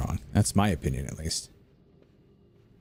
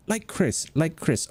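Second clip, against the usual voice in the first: higher-pitched imitation of the teacher; Japanese teacher; 8 semitones higher, slower than usual. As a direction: mimic a Japanese teacher instructing the class. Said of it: "She'd say".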